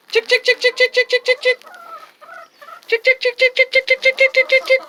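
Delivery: very high rapid chick-chick call; sing-song high call to the flock; calling chickens. Real, not acted. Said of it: "I've been training my girls to recognize that sound".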